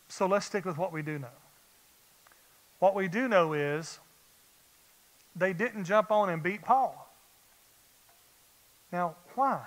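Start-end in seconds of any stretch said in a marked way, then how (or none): none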